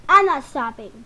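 loud, firm declaration; insistent. Real, not acted. To her viewers, loudly refusing to stop the video. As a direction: plant your feet and tell them loudly.

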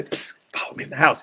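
quick hushed aside; furtive whisper. Pretend. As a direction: whisper it like a secret summons. What Jesus did not say, furtively.

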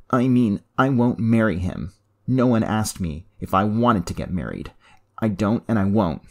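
the daughter digging in her heels; defiant. Acted. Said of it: "glaring defiantly at her father".

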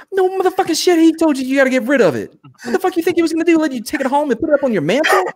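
very high, quick mock tirade; exasperated scolding. Platoon leader berates lieutenant about getting rid of it, exasperated scolding. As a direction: chew him out in a high-pitched rant.

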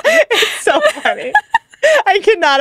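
high, slow, laughing it out. Real, not acted. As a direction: let the laugh carry the words. Laughing about Michael B. Jordan.

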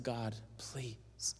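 hushed, breath-only plea; whispered prayer. Retold or imitated. Husband praying for his wife, whispered plea. Retold desperate prayer while driving.

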